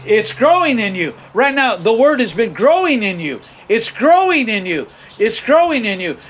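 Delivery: rising, emphatic preacher cadence; preaching refrain. Acted. Preacher pressing the congregation with a repeated refrain. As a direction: drive the refrain home to the congregation.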